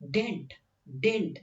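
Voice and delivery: "Didn't" as one joined word, did-nt; contracted didn't. Shown as a demonstration. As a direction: say "Didn't" all together, not "did not". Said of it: "एक साथ बोलने की कोशिश करो". ("didn't" एक साथ बोलो)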